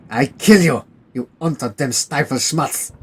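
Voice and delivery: put-on hostile German soldier in a German accent; the German drunk telling the English to leave, German accent. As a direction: warn the strangers off in a thick German accent.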